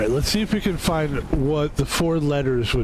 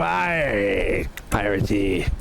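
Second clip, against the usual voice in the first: pirate voice; 4 semitones lower, slower than usual. Pretend. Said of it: "talking like a pirate".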